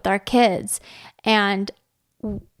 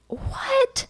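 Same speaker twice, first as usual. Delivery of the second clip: put-on much higher, bewildered little-kid "what?"; puzzled toddler; 8 semitones higher, much slower than usual. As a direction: mimic the toddler's baffled question. Son reacting to aunt's mood.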